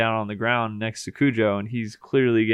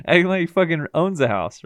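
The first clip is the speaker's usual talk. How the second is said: laughing; 6 semitones higher, 4 dB louder than usual. Real, not acted. Laughing while describing.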